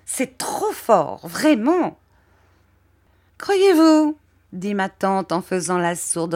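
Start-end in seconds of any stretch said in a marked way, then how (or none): 0.1-2.0 high, slow, affronted drawl; high prim lady, scandalised and slow; outraged Miss Murdstone. A narrator's reading. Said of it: "s'écria miss Murdstone". 3.4-4.2 high, loud scornful retort; loud mocking question from the aunt; disdainful aunt. A narrator's reading. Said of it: "d'un air de suprême dédain".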